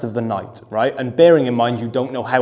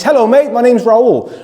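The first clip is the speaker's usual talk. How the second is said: high, louder cheerful hello; beaming friendly greeting; 10 semitones higher, 7 dB louder than usual. Retold or imitated. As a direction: kill him with kindness. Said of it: "beaming smile on his face".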